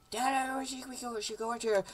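put-on high, soft kid voice; whiny son. Son asking dad to go in, whiny kid voice. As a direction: plead in a high little son voice.

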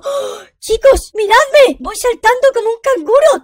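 high, loud, thrilled calling out; amazed excitement. Pretend. Jumping like a kangaroo for viewers, amazed shouting. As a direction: call out to everyone in high-pitched amazement.

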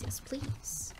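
breathy whispered yes please. Shown as a demonstration. Streamer wishing for the cottage life, whispered yes please. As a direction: whisper it like a longing secret.

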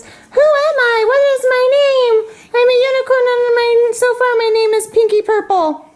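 high, squeaky-pitched talking unicorn; unicorn toy voice. Pretend.

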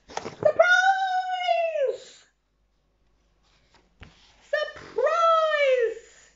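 high, loud happy shout. Acted. Villagers shouting surprise to the pirates. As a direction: shout it out with joy. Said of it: "shouted the villagers".

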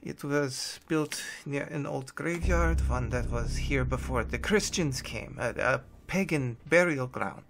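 put-on shaken, hesitant German-accented account; frightened German captive. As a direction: confess haltingly with a German accent. Plays Hess, frightened German captive.

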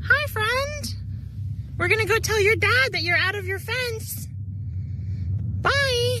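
high sing-song farmer voice; cheery high character voice. Pretend. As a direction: play the friendly neighbor talking to a cow. Voicing Mr Wetzel greeting cow.

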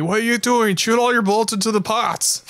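high-pitched exasperated mock yell; mock screaming. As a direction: mock-scream at a partner in disbelief. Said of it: "You're just screaming at me".

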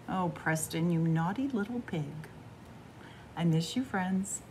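plain reading voice

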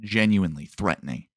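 normal talking voice

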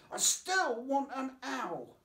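put-on high, slow, insistent little girl; insisting child. A narrator's reading. Little Lucy insisting to her mum about the owl, high and slow. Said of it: "Lucy insisted".

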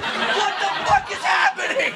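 very high-pitched mock shriek; hammed-up exasperation. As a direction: squeal in comic outrage at the blockage. Acted outburst at blocking tourists.

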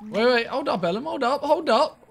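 urgent. Real, not acted. Stopping a teammate, rising pitch.

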